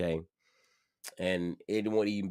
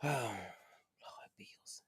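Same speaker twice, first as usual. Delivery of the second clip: whispered sigh; 14 dB quieter than usual. Real, not acted. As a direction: sigh then a whispered lord, bills. Weary about the bills.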